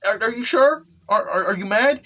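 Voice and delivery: high, stammered timid question; anxious asking. Acted. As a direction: act worried about having angered someone. Character anxiously asks forgiveness.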